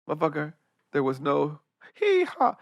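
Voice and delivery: normal talking voice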